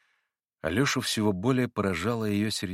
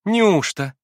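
high, louder cry of astonishment; much higher startled exclamation; surprised cry; 10 semitones higher, 8 dB louder than usual. A narrator's reading. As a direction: blurt out in disbelief. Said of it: "вскрикнула Лиза в удивлении".

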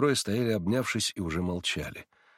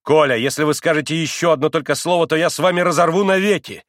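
loud, higher, authoritative warning; commanding shout; 7 semitones higher, 7 dB louder than usual. A narrator's reading. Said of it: "властно крикнул Алёша". (властный окрик)

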